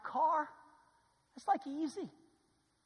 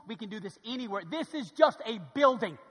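ordinary talk

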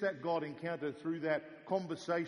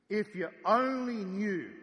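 slow, emphatic plea; 4 semitones higher, 6 dB louder, slower than usual. Acted. Jesus quoted, drawn out.

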